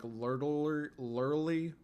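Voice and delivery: "Lurtler-, lurly" slurred, R and L sounds blurred; slurred speech. Shown as a demonstration. Streamer imitating his childhood slur on "Lurtler-, lurly". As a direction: stumble through "Lurtler-, lurly" like a kid who can't say it. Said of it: "instead of saying literally, I'd be like".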